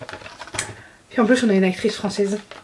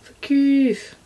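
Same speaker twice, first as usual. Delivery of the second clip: ordinary delivery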